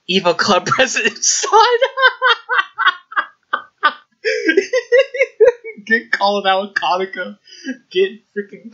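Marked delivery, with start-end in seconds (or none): 0.1-5.9 laughing. Real, not acted. Repeats title laughing.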